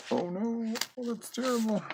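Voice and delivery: high, loud hammed-up gasp of dismay; cartoon voice fussing over the torn page; mock horror. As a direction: squeal it like a scandalised cartoon lady.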